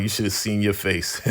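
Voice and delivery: tease, then break into a laugh; imitated gloating line capped with laughter. Coop revealing the prank to Spencer, laughing. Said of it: "Coop starts laughing like".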